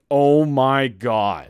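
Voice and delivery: loud, hugely drawn-out awe; slow, booming, hammed-up craving; Joe's awestruck hunger. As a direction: voice a starving guy's amazement, very slowly. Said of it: "Joe is like".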